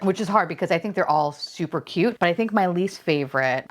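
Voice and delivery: normal talking voice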